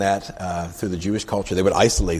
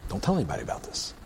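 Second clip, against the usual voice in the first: hushed secrecy; 8 dB quieter, faster than usual. Acted. Jesus swears the healed man to secrecy, hushed. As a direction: keep it hush-hush, fast and soft.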